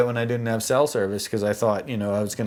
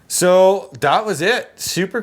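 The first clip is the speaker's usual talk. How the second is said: emphatic exclamation; 8 semitones higher, 6 dB louder than usual. Real, not acted. Recounting the drone crash.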